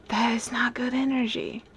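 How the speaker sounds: soft unvoiced warning whisper. Real, not acted. Tarot reader warns couples about bad energy, whispered. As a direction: whisper it like a secret caution. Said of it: "whispered warning to viewers".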